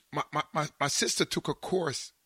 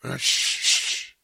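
shushing; much slower than usual. Retold or imitated. Hushing the family.

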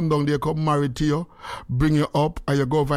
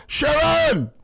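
calling out; 10 semitones higher than usual. Real, not acted. Calling out to Sharon.

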